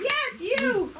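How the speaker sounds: very high, playful summons; calling out. Real, not acted. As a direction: call the performer up in a high voice. Calling a performer to the stage.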